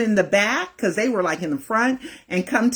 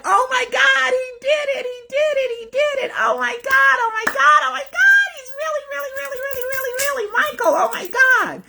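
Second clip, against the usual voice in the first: ecstatic shriek. Retold or imitated. Fan realizing Michael arranged the meeting, shrieking with tearful joy. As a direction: scream it like your dream just came true. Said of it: "I was like".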